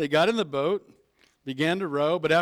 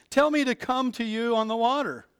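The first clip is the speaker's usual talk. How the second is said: higher, eager plea across the water; eager call; 7 semitones higher, 3 dB louder than usual. Acted. Peter calling to Jesus across the stormy water, eager. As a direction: call out boldly to him.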